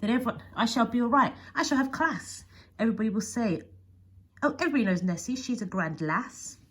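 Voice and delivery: northern accent. Shown as a demonstration. Nessie imagining being admired, in a northern accent. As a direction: try a northern English accent, short A in "lass". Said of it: "more a northern accent".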